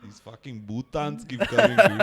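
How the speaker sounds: very slow South Indian English drawl; South Indian accent. Shown as a demonstration. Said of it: "South Indian English में मजा आता है".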